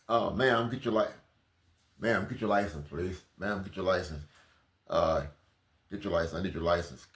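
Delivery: a lightly done stern cop; mock officer. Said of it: "put it on".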